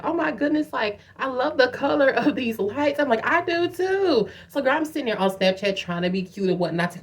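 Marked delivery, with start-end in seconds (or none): 0.0-3.0 high-pitched tipsy-sounding gush; gushing sister. Retold or imitated. Sister admiring the lights on the ground, high gushing imitation. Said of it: "She's like". 3.3-4.3 high, drawn-out gush; gushing agreement. Retold or imitated. Agreeing with sister about the lights, high drawn-out gush.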